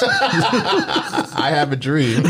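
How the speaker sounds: slow, high, grand speechmaker voice with a laugh in it; mock speech. Teasing his friend, a slow mock grand speech. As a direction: stretch each word like a famous orator, laughing. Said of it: "to having speeches".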